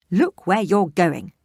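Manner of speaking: loud, slow huffy scolding. Acted. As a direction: snap at the clumsy girl.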